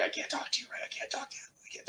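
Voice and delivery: urgent hushed whisper. Retold or imitated. Waving his girlfriend away from the phone, frantic whisper. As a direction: whisper it so the caller can't hear.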